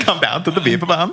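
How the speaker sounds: giggling through a mock German accent; laughing German accent. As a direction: repeat it while cracking up. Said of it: "He's losing it".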